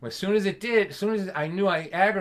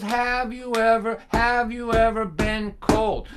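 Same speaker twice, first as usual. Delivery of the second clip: slow pointed dad question; 6 semitones higher, 5 dB louder, slower than usual. Retold or imitated. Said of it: "he goes".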